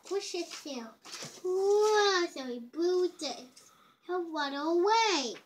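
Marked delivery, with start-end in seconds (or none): none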